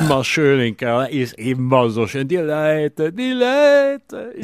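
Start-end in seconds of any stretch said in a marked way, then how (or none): 2.3-4.3 gushing. Acted. Gushing about Cologne people.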